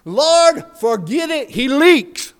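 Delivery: loud, high shout from the back of the church; Denny shouting. Acted. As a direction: holler it across the congregation. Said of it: "Denny Williams' voice shouting".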